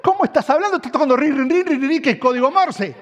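hammed-up dad who can't believe his son; incredulous dad. Father mocking the son's ring code, mock-exasperated and loud. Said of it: "Mi papá me decía".

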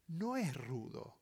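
ordinary talk, nothing marked

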